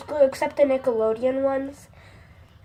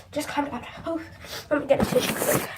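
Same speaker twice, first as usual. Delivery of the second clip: usual speaking voice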